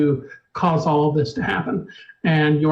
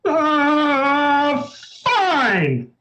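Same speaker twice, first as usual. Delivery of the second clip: higher, whining groan; moaning portrait; 7 semitones higher than usual. A narrator's reading. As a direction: give in with a loud moan. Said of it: "moans loudly".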